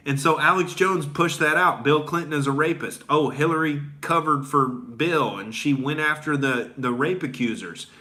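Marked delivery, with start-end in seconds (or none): none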